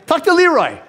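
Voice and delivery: high, fast, pleading thought; panicky inner plea. Retold or imitated. Thinking at the stranger, high fast panicky plea. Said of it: "that's how intimidated I was of people".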